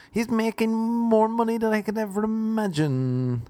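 high-pitched mock complaint; put-on high, envious voice; resentful begrudger. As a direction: whine the grudge out up high. Begrudger answering his questioner.

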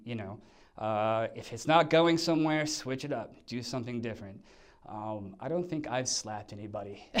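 usual speaking voice

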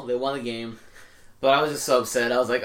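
ordinary delivery

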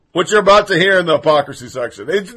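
normal talking voice

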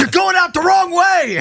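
high loud shouted scolding; mock scolding woman. Clerk scolding a customer, high shout. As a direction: yell it like an outraged clerk.

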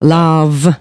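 ordinary talk, nothing marked

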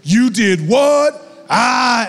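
high, loud, stretched-out celebration; acted whoop of excitement; thrilled disbelief. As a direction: blow up with joy at big news. Acted reaction to graduation news.

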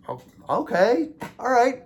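high-pitched stunned acceptance; taken aback. Retold or imitated. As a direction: replay being verbally slapped, voice up high. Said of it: "I actually felt like".

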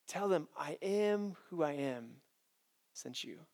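slow, hushed and grave; solemn God voice. Acted. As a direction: let God answer slowly and quietly. God answers Moses, solemn.

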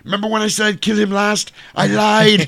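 high-pitched comic action-star impression; Arnold impression. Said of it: "And Arnold's like".

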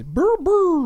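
high, loud double monster cry; two high-pitched wordless creature bursts; Grimace. Acted. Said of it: "He says".

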